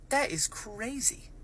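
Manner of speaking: hushed incredulous awe; slow amazement. Real, not acted. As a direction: let the disbelief stretch out.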